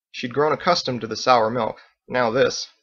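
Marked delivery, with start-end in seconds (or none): none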